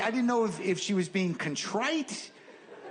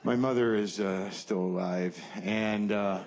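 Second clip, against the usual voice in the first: mock somber tone; 7 semitones lower than usual. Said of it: "sorry, wrong tone".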